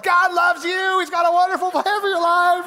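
high, loud shout over blaring music. Retold or imitated. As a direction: yell the good news across a noisy table. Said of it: "shout the gospel".

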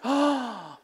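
sudden acted gasp of realization; delighted gasp. Bartender hearing the pastor's car-selling tip, delighted gasp. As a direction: gasp as if struck by an idea. She suddenly likes his suggestion.

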